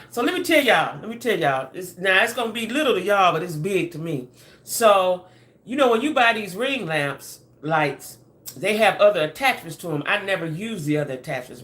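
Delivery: usual speaking voice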